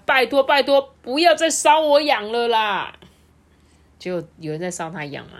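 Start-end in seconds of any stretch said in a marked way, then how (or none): 0.1-2.9 loud, rising high plea; pleading protest. A narrator's reading. Doctor tickled by hay, loud high pleading. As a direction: beg them loudly to stop.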